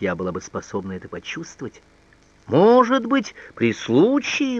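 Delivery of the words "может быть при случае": high, indignant echo, drawn out at the end; возмущённое восклицание. A narrator's reading. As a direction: throw the words back as an exclamation. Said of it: "воскликнул он". (возмущённо, высоким голосом, растягивая слова)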